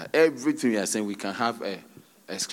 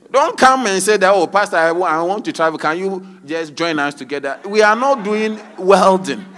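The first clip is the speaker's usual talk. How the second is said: loud, high admonishment easing then surging again; mock scolding; 6 semitones higher, 8 dB louder than usual. Pastor warning members against rushed wedding requests, loud mock scolding. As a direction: raise your voice in emphatic reproof.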